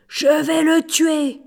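slow, loud, high raging threat; furious threat. A narrator's reading. Schultz furious at the victim, raging shout. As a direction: threaten to kill him in a rage. Thief enraged at fake gold.

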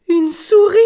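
high, loud shriek of alarm; horrified scream. A narrator's reading. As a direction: scream it as at a sudden fright. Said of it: "en un hurlement qui les glaça". (hurlement aigu et effrayé)

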